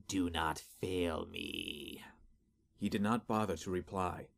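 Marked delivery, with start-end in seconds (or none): 0.1-2.0 slow, low, cold command; menacing master voice. Acted. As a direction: speak down to a servant with menace. Master creature's final threat.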